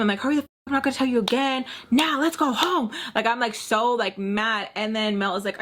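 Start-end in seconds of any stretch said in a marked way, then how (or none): 0.2-2.9 high, rapid scolding without real volume; retold yell, held back; mild mock scream. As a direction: rattle off an exasperated order, pitch climbing. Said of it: "I'm screaming".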